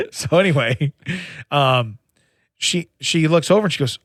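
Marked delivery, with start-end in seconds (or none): none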